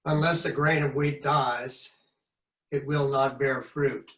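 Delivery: ordinary delivery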